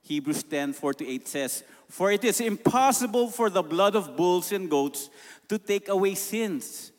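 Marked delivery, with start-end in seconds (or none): 1.9-6.9 scripture reading. Acted. Reading Hebrews aloud.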